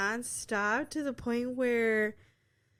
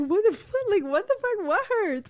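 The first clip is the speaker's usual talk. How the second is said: loud, high, alarmed demand; alarmed retort; 9 semitones higher, 6 dB louder than usual. Retold or imitated. Retelling her reaction to his screaming, alarmed yelling. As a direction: yell it in startled bewilderment.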